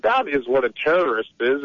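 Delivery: ordinary talk, nothing marked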